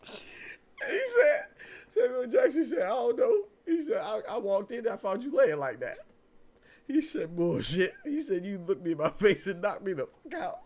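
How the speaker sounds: high, loud, laughing retelling; laughing through the story. Real, not acted. Fan retelling a Soul Man scene, laughing hard. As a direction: squeal the lines through laughter.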